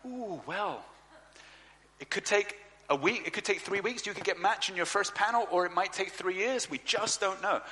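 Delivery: a hint of a breezy caseworker; social worker voice. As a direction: play the vague official shrugging it off.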